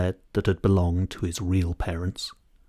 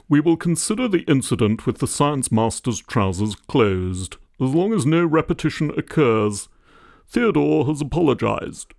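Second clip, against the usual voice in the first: a lightly voiced formal headmaster, a touch louder; pompous school-report headmaster, a little raised; headmaster's voice; 5 semitones higher, 3 dB louder than usual. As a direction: read it out like a headmaster's stiff report note. Headmaster's written comments read out.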